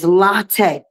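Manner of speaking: latte said LAH-tay, open ah; latte, open LAH then tay; correct Latte. Shown as a demonstration. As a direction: latte with a full LAH first syllable. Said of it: "not latte".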